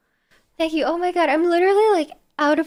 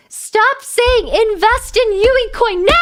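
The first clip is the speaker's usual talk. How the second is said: high, loud, drawn-out outburst; exasperated shout; 6 semitones higher, 7 dB louder, slower than usual. Real, not acted. Fed-up streamer scolding chat over a fake coin, exasperated shout. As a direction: shout at them to quit it.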